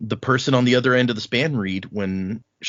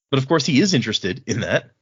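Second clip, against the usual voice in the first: normal talking voice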